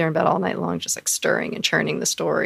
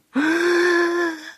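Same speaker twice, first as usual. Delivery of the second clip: high, sharp imitated gasp; mock gasp; 13 semitones higher than usual. She mimics her boyfriend hearing about the date with a gasp.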